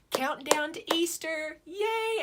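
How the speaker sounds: high, loud little cheer. Real, not acted. Celebrating the countdown to Easter, high cheer. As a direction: squeal it with delight.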